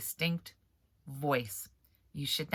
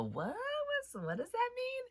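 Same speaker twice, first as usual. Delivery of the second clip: much higher, puzzled put-on voice; puzzled character; 8 dB quieter than usual. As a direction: ask it as a baffled reader stand-in, way up high. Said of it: "have another character in the book go".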